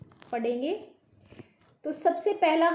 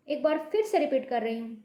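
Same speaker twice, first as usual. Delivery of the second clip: normal talking voice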